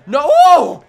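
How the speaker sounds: a sudden high, loud cry of shock; shocked shout. Real, not acted. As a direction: yelp at the big hit. Big hit in the game.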